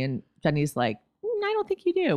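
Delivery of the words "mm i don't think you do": high-pitched, dubious pushback; mock doubtful reply. Jenny doubting Max's need for a taser, mock doubtful. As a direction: imitate a sceptic in a high voice. Acted sceptical reply in retelling.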